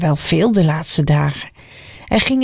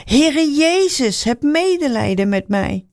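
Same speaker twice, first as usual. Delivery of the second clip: crying out louder; 7 semitones higher than usual. A narrator's reading. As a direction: call even harder over the crowd. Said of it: "Hij riep nog veel harder". (blinde man roept nog harder naar Jezus, hoog en smekend)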